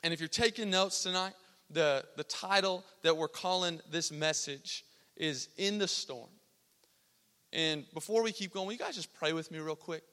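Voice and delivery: ordinary talk, nothing marked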